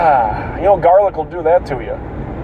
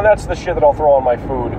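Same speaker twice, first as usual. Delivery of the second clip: ordinary delivery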